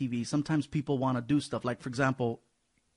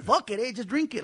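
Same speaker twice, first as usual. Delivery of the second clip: mock Chicano shrug; 9 semitones higher than usual. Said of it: "they're like".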